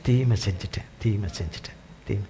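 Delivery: Saul's remorse, trailing off in quiet tears; weeping confession. Acted. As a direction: fade into a broken sob of regret. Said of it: "Saul சொல்றாரு".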